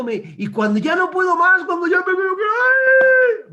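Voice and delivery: a believer at the end of his strength, crying out; building to a cry. Acted. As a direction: climb until you break into a cry. Preacher acting out despair.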